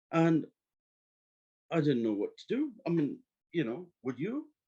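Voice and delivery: ordinary talk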